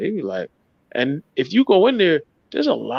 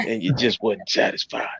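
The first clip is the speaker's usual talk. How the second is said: whispering; 5 semitones lower, 4 dB quieter than usual. Real, not acted. Teasing whispered question.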